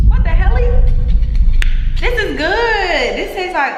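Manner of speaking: high, loud surprised outburst; shocked delight. Real, not acted. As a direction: blurt it out, amazed. Tasting the lemonade soda.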